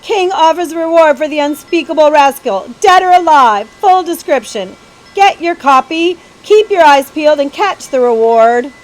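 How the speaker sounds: pierce the air like a crow; news seller yelling headlines to the crowd. A narrator's reading. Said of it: "A crow-like voice pierced the air".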